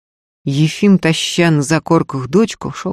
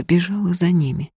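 ordinary delivery, nothing marked

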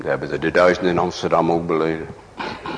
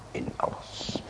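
whisper; 14 dB quieter than usual. Acted. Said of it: "Ik zeg".

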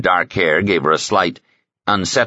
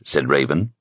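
ordinary delivery

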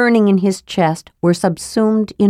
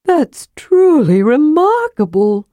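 much higher, awed sigh; sighing in wonder; 9 semitones higher, 3 dB louder than usual. Acted. Kender admiring the chamber, awed sigh. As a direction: breathe it out in awe.

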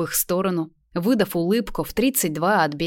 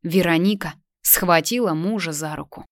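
plain reading voice

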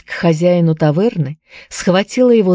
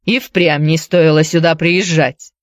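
plain reading voice

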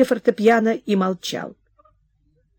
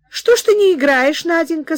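high, raised call to her daughter; mother calling; 9 semitones higher, 4 dB louder than usual. Acted. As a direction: call out from the other room. (мать громко окликает дочь)

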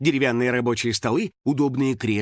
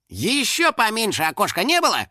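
high-pitched grumbling; Финик complaining; 7 semitones higher than usual. A narrator's reading. Финик facing a tiny window, complaining. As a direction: whine about it in a high voice. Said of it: "пожаловался Финик".

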